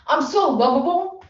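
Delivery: usual speaking voice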